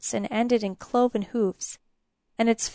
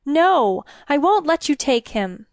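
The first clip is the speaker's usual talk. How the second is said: higher, louder, pleading defiance; desperate refusal; 5 semitones higher, 5 dB louder than usual. A narrator's reading. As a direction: refuse urgently to protect the child. Queen refuses to give up child.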